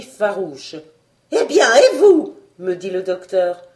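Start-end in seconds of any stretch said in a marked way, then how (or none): 1.3-3.6 loud, pressing orders, faster at the end; impatient command. Acted. The doctor orders the narrator to help pump, loud and impatient. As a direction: push them to act now.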